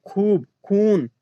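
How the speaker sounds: खूब, खून each with a long "oo"; slow demo of खूब, खून, vowels held; drawn-out long vowel. Shown as a demonstration. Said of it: "खींचकर पढ़ा जाता है".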